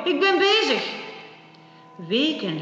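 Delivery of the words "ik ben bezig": angry shout. Acted. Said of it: "riep de koning". (hoge, luide boze uitroep)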